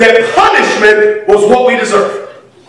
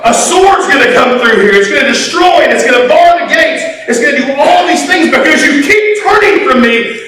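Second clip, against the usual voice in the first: raised, forceful warning, building; God's warning, loud; 8 semitones higher, 4 dB louder than usual. Retold or imitated. God warning his people, loud and forceful. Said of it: "'cause he goes".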